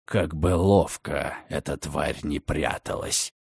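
low, soft, slow menacing voice; a dark, quiet oath of revenge; menacing vow. Acted. Redfern swears to find the creature, low menacing voice.

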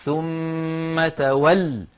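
"ثم تول" with a long held m and a pressed final l; وقف بالضغط على اللام. Shown as a demonstration. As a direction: stop on "تول", leaning hard on the doubled l. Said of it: "ولا بد من الضغط على اللام المشددة". (الوقف على «ثم تول» بالضغط على اللام المشددة)

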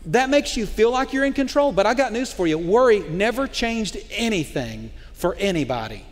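ordinary talk, nothing marked

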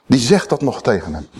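usual speaking voice

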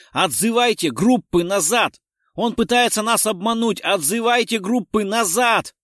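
loud, high, insistent yell; urgent shouting. A narrator's reading. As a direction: yell the retreat order with heavy force. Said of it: "закричал Дронго". (настойчивый крик во весь голос)

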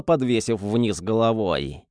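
plain reading voice

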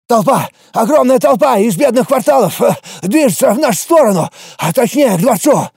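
high, breathless alarmed report; urgent panting report. Acted. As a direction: gasp it out after running. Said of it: "Запыхавшись, выговорил гонец".

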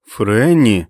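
drawn-out reproving call of a name; quiet exclamation. A narrator's reading. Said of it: "тихо вигукнув Стю". (застережливий вигук)

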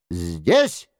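abrupt cry leaping up from low; brief narrated exclamation from an unseen voice; sudden cry. A narrator's reading. Unseen man answering where the jester is, sudden cry. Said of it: "вскричал чей-то голос".

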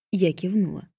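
ordinary delivery

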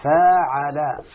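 فاعل spoken very slowly and deliberately; slow demo. Shown as a demonstration. Teacher slowly giving the pattern فاعل to the student. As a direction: spell out فاعل syllable by syllable. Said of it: "على وزن إيه".

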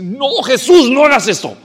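más alto y fuerte, tajante; voz del cielo. Retold or imitated. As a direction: una voz del cielo que interrumpe con fuerza. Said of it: "se hubiera oído la voz del cielo". (la voz del cielo detiene a Jesús con fuerza)

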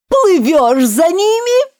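high, loud, slow whoop of joy; joyful yell. A narrator's reading. Gryphon whoops the dance to Alice, overjoyed. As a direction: yell it out in delight. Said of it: "радостно завопил Грифон".

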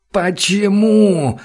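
high, loud, long-drawn wail; anguished cry, stretched very slow; despairing howl. A narrator's reading. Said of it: "в отчаянии воет он".

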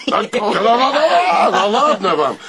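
high, laughing brush-off; laughing dismissal. Acted. Klinkov laughing off his friend's jab about prisons. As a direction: laugh the teasing away.

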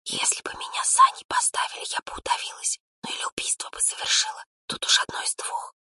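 very soft gossiping whisper. A narrator's reading. Older student gossips about the lad, whispering. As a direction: whisper it to friends, barely voiced. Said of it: "услышала она шёпот за спиной".

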